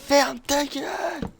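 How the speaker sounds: aigu et lent, voix de parodie; hurle-le en parodie, très haut; parodie criée. Pretend. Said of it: "je vais la faire cette parodie". (parodie aiguë et lente)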